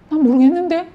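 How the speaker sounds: high-pitched mock evasion; imitated innocent "no idea"; feigned ignorance. Acted evasive answer of cornered leaders.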